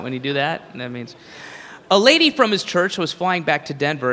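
ordinary delivery

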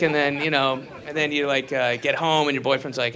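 ordinary talk

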